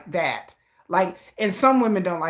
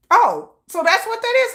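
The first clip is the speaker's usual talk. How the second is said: surprised realization; 5 dB louder than usual. Retold or imitated. Sudden realization in retold quote.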